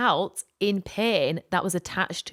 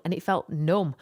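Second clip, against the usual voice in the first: normal talking voice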